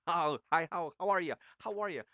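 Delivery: high, loud, hyped-up greeting; real excitement. Real, not acted. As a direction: burst in loud and high, thrilled to greet everyone. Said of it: "I'm excited".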